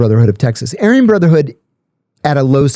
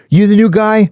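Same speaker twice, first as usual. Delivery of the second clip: imitating an intimidating inmate, pitched up; retold tough challenge; 9 semitones higher than usual. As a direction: size up the newcomer. Swastika prisoner challenges him.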